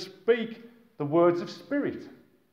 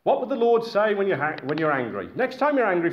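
usual speaking voice

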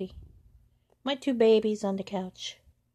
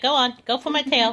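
higher, louder taunting cat voice; voicing the cat; 7 semitones higher, 9 dB louder than usual. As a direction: tease like a cocky cat. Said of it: "He's like".

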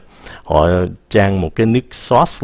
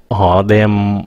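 usual speaking voice

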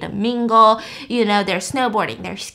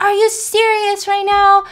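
indignant disbelief; 8 semitones higher, 4 dB louder than usual. Retold or imitated. Skiers told lift closing.

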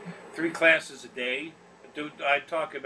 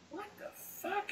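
startled; 14 dB quieter, slower than usual. Retold or imitated. Shocked reaction in the airport.

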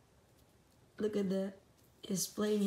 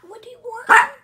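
much higher and louder coo; loud high pet call; 11 semitones higher, 19 dB louder than usual. Real, not acted. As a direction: call out to the dog, squeaky and loud. Loud high baby-talk.

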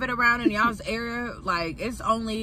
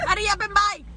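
higher, more insistent hurry-up; pushy salon worker; 8 semitones higher, faster than usual. Retold or imitated. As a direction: rush them harder, pitch up. Repeated imitation.

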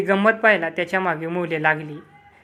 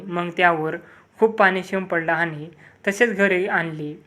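plain reading voice

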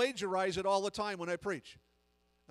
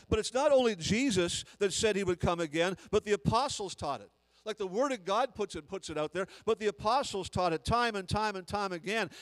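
loud fervent preaching; full-voiced pulpit preaching; preaching at full force; 5 dB louder than usual. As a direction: build it like a revival sermon.

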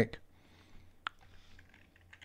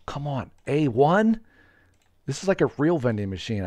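normal talking voice

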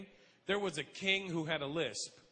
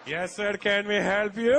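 slow, louder Indian shopkeeper; Indian accent; 3 semitones higher, 7 dB louder, slower than usual. Retold or imitated. Shopkeeper greeting a customer, Indian accent. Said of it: "look as Indian".

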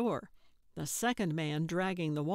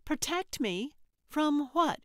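high-pitched, disbelieving questions; incredulous; 9 semitones higher, 3 dB louder than usual. A narrator's reading. Dancer challenges her would-be protector, incredulous. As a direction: throw it back at her, pitched right up. Trixie reacts to claim of protection.

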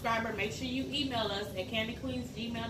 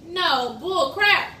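loud protest; 6 semitones higher, 12 dB louder, much slower than usual. Real, not acted. Protesting loudly.

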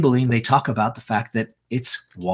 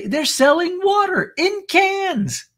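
incredulous squeal; slower than usual. Real, not acted. Mocking disbelief at canned water.

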